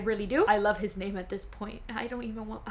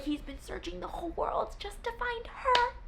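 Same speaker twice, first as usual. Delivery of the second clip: much higher and softer; swooning; 10 semitones higher, 9 dB quieter than usual. Real, not acted. Swooning over Rowan's search for her, high and soft. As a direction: sigh it out dreamily.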